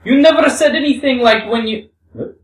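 high, loud accusation; indignant retort, pitched well up; Grushenka, angry. Acted.

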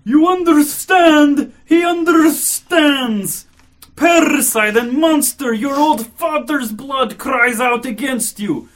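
high, slow, thundering accusation; furious roar. Acted. Old police commissioner accuses Mitya of parricide, roaring beside himself. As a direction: roar it, stretching every word. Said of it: "police commissioner suddenly roared".

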